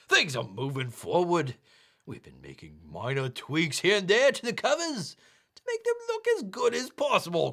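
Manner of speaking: broad mock Aussie reading, swinging up high; bad Australian accent. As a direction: read the update as a cartoon Australian, slightly English. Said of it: "I gotta do this in the accent".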